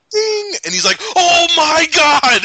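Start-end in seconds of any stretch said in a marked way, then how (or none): 0.1-0.5 high, loud bell ding; imitated ding. Host imitating the moment it clicks, high loud ding. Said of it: "you actually hear the". 1.1-2.4 high, loud, drawn-out exclamation; mock freak-out. Host imitating the partner's realization, hammed-up freak-out. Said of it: "he, like, freaks out".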